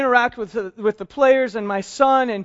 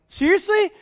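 high, disbelieving Seriously; a mock double take; incredulous; 12 semitones higher, 4 dB louder than usual. Pastor retelling his reaction to a friend, incredulous.

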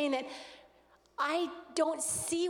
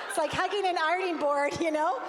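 laughing through the words, louder; can't hold back the giggle; real laughter while explaining the awkward hug; 3 semitones higher, 5 dB louder than usual. Real, not acted. Laughing at the ironing-board hug.

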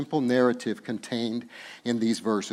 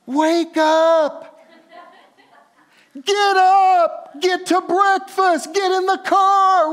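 loud, much higher shouting; parent yelling at kids; 10 dB louder, much slower than usual. Pretend. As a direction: bark orders at sleepy kids. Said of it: "have that power over your kids".

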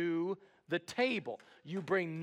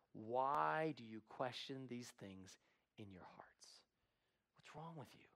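whisper; 6 semitones lower, 12 dB quieter than usual. Acted. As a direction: hush it as Jesus. Jesus quote whispered.

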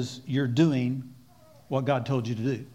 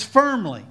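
firmly, punched higher and louder; 6 semitones higher, 4 dB louder than usual. Shown as a demonstration. Preacher showing how God rebukes, firmly. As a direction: hit firmly hard and high. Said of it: "when He says firmly".

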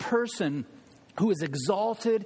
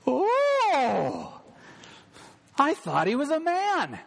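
surprised Susan; 10 semitones higher, slower than usual. Acted. Susan startled at learning Aslan is a lion, much higher voice. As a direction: gasp it out as young Susan.

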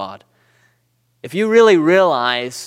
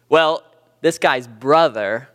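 usual speaking voice

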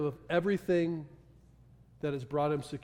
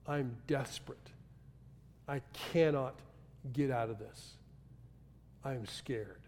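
hushed desperation; 4 semitones lower, 10 dB quieter than usual. Retold or imitated. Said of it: "heart of desperation".

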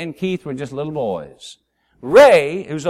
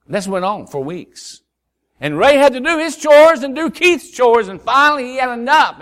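ordinary delivery

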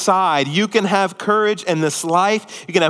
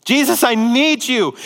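crying out for rescue; 7 semitones higher than usual. Acted. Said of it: "He cried out".

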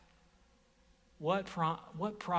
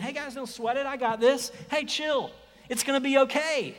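breezy, higher offhand reassurance; mock casual; 8 semitones higher, 6 dB louder than usual. Preacher mocking an offhand Jesus, breezy and casual. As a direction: toss it off like no big deal.

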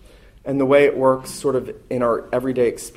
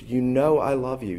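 ordinary delivery

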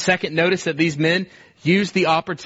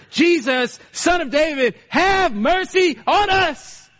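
high desperate cry, climbing much higher; crying out louder; 10 semitones higher, slower than usual. Retold or imitated. Blind men crying louder to Jesus, desperate high cry. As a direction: plead at the top of the voice, slowly on the last words. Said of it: "they cried out even louder".

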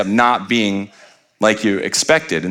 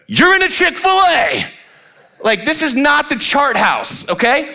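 exasperated retort; slower than usual. Retold or imitated. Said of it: "We're like".

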